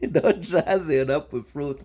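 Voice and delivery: slow, laughing through the line; laughing repeat. Shown as a demonstration. Repeating the guest's fruit line to her while laughing. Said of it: "repeats line laughing".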